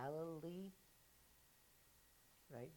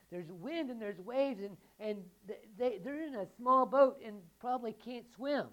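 louder, building the danger; fervent preaching; 8 semitones higher, 14 dB louder, slower than usual. Acted. Pastor to congregation, building urgency. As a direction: paint the storm with rising force.

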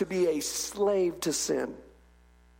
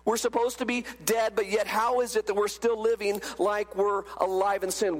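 normal talking voice

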